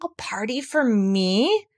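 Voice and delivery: slow, wondering bear voice; Pooh thinking aloud. Acted. Pooh thinking to himself, slow and wondering. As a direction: savour the idea slowly. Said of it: "thought Pooh to himself".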